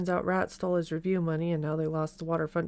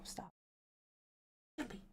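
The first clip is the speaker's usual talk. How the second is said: ordinary delivery, nothing marked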